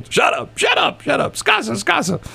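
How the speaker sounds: very high, imitated shouting; mock yelling match. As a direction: squawk it over and over like a bickering couple. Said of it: "they just start yelling".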